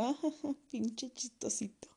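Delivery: whispered through a laugh, slow. Retold or imitated. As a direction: giggle it under your breath. (susurro entre risas)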